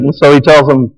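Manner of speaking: ordinary delivery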